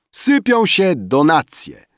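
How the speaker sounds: high, slow, drawn-out call; loud remark. A narrator's reading. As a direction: call it out slowly over the clamor. Notary calls out amid clamor. (wysoki, przeciągły okrzyk)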